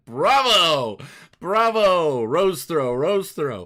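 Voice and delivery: big drawn-out cheer, high and loud; cheering bravo. Pretend. Co-host to guest after his recital, cheering bravo. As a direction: applaud the performer with swooping bravos.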